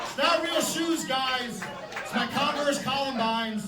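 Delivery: loud, high, slow shout; calm the crowd with a yell; shouting to the crowd over their reaction; shouted aside. Real, not acted. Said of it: "calling out to crowd".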